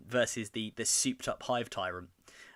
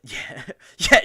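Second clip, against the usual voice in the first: laughing; 9 semitones higher, 15 dB louder than usual. Real, not acted. Laughing at chat comment.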